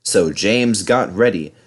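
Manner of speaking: ordinary delivery, nothing marked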